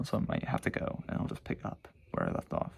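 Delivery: whispers so dad won't hear; sneaky hushed whisper. Real, not acted. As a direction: drop to a nervous whisper so nobody upstairs hears. Hushing, dad may come down.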